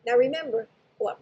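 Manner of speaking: ordinary talk, nothing marked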